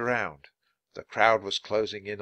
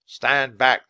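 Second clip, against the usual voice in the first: loud, firm command; shouting; 2 semitones higher, 7 dB louder than usual. Acted. Policeman to the pushy kid, shouting. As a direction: order them back at volume. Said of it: "the policeman shouted".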